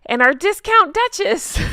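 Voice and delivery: high, loud, mock-grand announcement; gleeful title announcement. Gleefully reads mocking title, then laughs.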